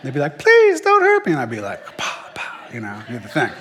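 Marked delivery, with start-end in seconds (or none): 0.4-1.3 frightened bully. Retold or imitated. Bully begging big brother. 2.0-2.7 whispered pow sound effect. Retold or imitated. Big brother hitting the bully.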